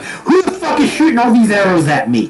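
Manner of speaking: high-pitched outraged demand; indignant outburst. Acted. Game master as the arrow target, indignant outburst. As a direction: play someone incensed at being shot at.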